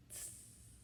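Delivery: soft hiss. A narrator's reading. Millie hissing at the puppy in its basket, soft hiss. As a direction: hiss a quiet tss. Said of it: "in case the Scotty hadn't understood".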